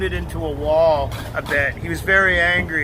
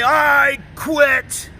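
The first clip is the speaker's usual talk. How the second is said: mock shouted quit; 5 semitones higher, 3 dB louder than usual. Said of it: "And he'll say".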